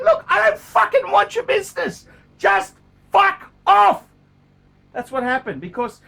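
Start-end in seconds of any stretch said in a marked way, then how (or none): 0.0-4.0 retold furious outburst, pitched much higher and louder; angry dealer. A dealer telling a haggling customer to get lost, angry shout.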